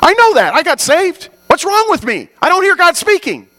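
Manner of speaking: high, loud mock whine of a frustrated believer; whiny complaining believer. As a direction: whine like a kid who feels left out.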